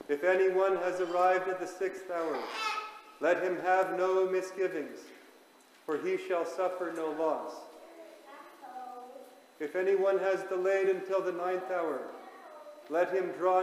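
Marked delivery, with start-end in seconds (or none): none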